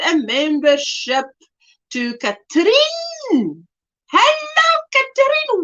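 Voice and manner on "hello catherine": very high, sung-out hello; excited greeting. Real, not acted. Welcoming a new member to the channel, sung out high. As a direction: greet her with a high delighted lilt.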